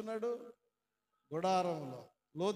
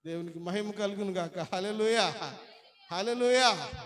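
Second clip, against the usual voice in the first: preacher's shout; 8 dB louder than usual. Real, not acted. Preacher shouting praise with the congregation. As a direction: raise the church to its feet.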